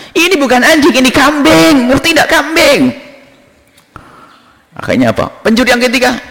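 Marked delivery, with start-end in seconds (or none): 0.1-2.9 high-pitched, indignant correction; acted high, exasperated protest; exasperated insistence. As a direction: insist like you're losing patience. Buyer angrily insists it's a goat.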